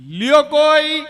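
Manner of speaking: high, loud hawker's cry on a train; vendor's call. Acted. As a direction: call it out like a fruit seller on the platform. Chikoo seller calling out.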